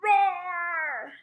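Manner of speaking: high, loud roaring cub; bear cub roar. A narrator's reading. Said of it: "Oliver gives a bigger roar".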